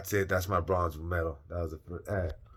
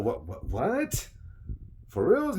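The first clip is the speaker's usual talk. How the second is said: stunned disbelief; 10 semitones higher, slower than usual. Retold or imitated. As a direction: build from daze to shock. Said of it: "I go".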